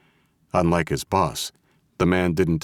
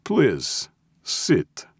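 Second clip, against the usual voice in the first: put-on higher, courteous Russian accent; heavy Russian accent; 8 semitones higher than usual. A narrator's reading. Russian boss offering the old man a seat, heavy Russian accent. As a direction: invite politely with a Russian accent. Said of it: "in a heavy Russian accent".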